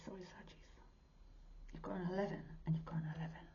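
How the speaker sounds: quick hushed apology; fast whispered aside. Real, not acted. Apologising to Sagittarians, a fast whisper. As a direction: rush it in a whisper. Excited aside during card pull.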